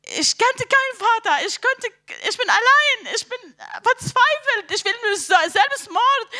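high-pitched, despairing outpouring; heart crying out. Retold or imitated. Explaining why her heart screamed, desperate outcry. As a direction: pour out despair with the pitch lifted. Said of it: "mein Herz hat geschrien".

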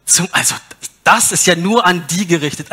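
normal talking voice

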